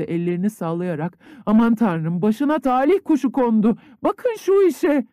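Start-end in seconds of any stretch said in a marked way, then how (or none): 1.5-5.1 high, loud astonished cry, slowing at the end; exclaiming. A narrator's reading. Aunt crying out at the peasant's luck, high and astonished. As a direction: throw up your hands in amazement. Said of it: "diye haykırdı".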